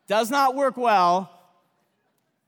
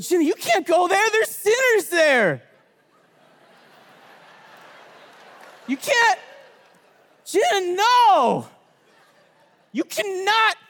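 half-joking insistence, going higher; emphatic mock warning; 5 semitones higher than usual. Friends forbid Jenna to work there, in a raised pleading voice.

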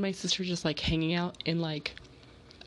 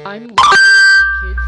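sudden loud slow shout; 6 semitones higher, 21 dB louder than usual. Real, not acted. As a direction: belt it out proudly. Loud burst about loving fire.

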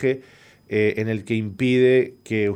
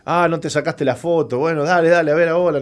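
playful imitation of nurse; 6 semitones higher than usual. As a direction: hurry him along, half joking. Imagined quote of nurse. (imitando a un enfermero apurado)

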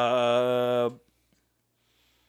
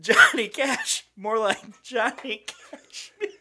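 squeaky laughing pun; 5 dB quieter than usual. Acted.

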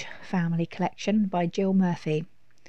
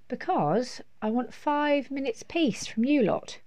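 exasperated mum; 6 semitones higher than usual. A narrator's reading. Mum tells children why she is escaping, measured exasperation. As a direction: spell it out to the children, pitched up.

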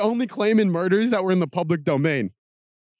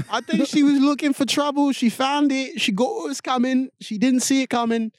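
higher, mocking accusing British voice; hammed-up British cop blaming the victim; skeptical accusatory British cop; 9 semitones higher than usual. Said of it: "skeptical when they're talking, but super accusatory".

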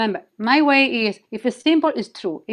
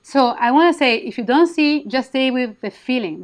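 usual speaking voice

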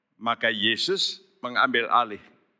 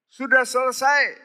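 quoting Jesus; 13 semitones higher, 6 dB louder than usual. Retold or imitated. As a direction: lift it up as the dying words. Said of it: "Dia sudah berkata".

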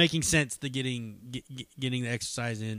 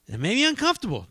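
blurted out higher, louder and fast; emphatic; 10 semitones higher, 7 dB louder, faster than usual. Real, not acted. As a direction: push the confession out quickly. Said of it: "to tell you the truth".

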